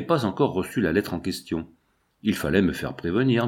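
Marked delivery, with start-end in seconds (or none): none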